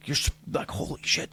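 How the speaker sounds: whispered in awe, imitating the friend; whispered holy shit; hushed retelling. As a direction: breathe it out like a stunned buddy. Friend's hushed pep talk retold.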